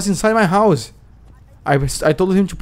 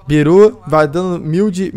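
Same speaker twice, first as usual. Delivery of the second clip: ordinary delivery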